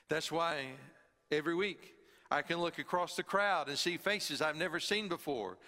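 ordinary delivery

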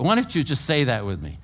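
ordinary delivery

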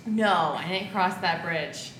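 ordinary talk, nothing marked

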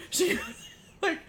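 breaks up laughing, high and breathy. Retold or imitated. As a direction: giggle the words out. Third repeat of she knows.

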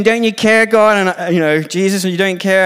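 high, loud, exasperated protest; loud, high-pitched aggrieved outburst; indignant complaint. Acted. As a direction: demand an answer from Jesus, aggrieved. Martha complains to Jesus.

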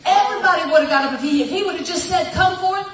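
loud, high, driving preach; preaching at full force. Real, not acted. Pastor pushing the church to call it forth, full force. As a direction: build it up and hammer it.

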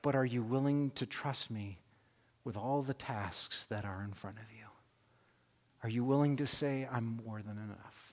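God gently questioning his son, hushed and tender; gentle voice of God. Retold or imitated. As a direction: speak tenderly, like a loving father.

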